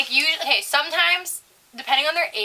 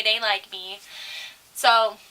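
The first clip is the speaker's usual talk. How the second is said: ordinary talk, nothing marked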